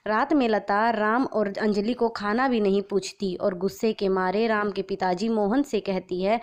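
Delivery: ordinary delivery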